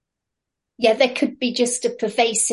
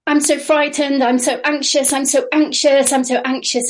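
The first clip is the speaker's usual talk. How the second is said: higher, louder frightened wailing of anxious phrases; demo of fearful offloading; 6 semitones higher, 4 dB louder than usual. Shown as a demonstration. Trainer demonstrating offloading to the class, mock frightened and higher. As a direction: show the group how a frightened client offloads. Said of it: "Thank you for that demonstration".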